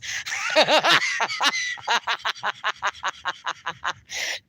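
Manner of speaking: long, rattling fit of giggles; long laugh. Real, not acted. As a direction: keep laughing in quick bursts, trailing off. Amused by Spanglish taco order.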